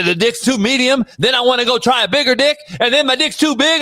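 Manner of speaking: normal talking voice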